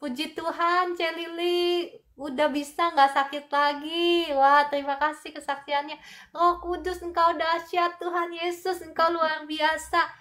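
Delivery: high, loud, jubilant praise to God; joyful praise. Real, not acted. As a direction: burst out in thankful praise. Praise after a healing testimony. (pujian penuh sukacita dengan suara tinggi)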